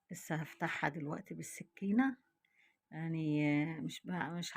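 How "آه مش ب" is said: usual speaking voice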